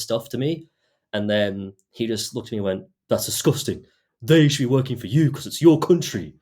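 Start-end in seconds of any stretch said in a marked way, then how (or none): 3.1-6.3 mock outrage, rising louder and higher; imitated indignant outburst. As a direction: build into a retold rant. Acting out racist customer's outburst.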